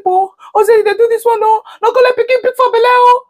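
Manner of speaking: high wailing plea, rising to a cry; hammed-up high lament to mother-in-law; mock wailing lament. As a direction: wail it out like a put-upon pregnant wife. Wife wailing complaint to mother-in-law.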